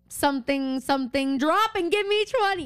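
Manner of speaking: hammed-up drill-sergeant order; mimicking a coach barking at recruits; mock bossy Arya. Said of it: "she says, like".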